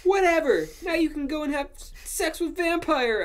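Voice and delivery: high, loud, melodramatic outburst; angry shouting. A narrator's reading. Ebony telling Draco to go off with Vampire, high shout. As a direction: shout it like a spurned teenager. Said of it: "I shouted".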